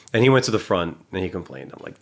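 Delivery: normal talking voice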